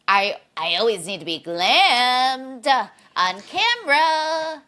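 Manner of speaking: high, slow glam diva. Retold or imitated. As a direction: drawl it like a pampered star. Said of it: "Erica was the one who brought the".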